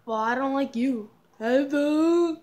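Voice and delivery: loud playful jeer; mock heckling. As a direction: shout back at the character, teasing. Heckling the game character back.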